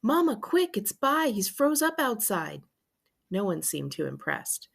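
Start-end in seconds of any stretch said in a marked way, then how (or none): 0.0-2.6 high, urgent plea; breathless-sounding alarm, lightly acted; urgent child. A narrator's reading. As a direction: call Mama in a high, pressing voice. Child bursting in for help.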